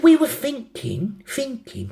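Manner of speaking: put-on slow, very high London accent. London girl leading up to a question, London accent. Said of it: "have a go at the accents".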